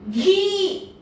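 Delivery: घी stretched with a high ई; long high ee. Shown as a demonstration. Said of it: "ध्वनियों को ध्यान से सुनिए". (घी को लंबा ऊंचा बोलें)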